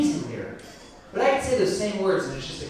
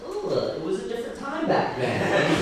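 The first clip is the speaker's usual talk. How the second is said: awkward excuse; 9 semitones higher than usual. Retold or imitated. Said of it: "And I was like".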